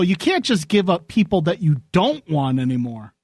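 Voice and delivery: usual speaking voice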